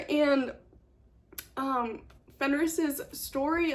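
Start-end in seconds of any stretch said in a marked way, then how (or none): none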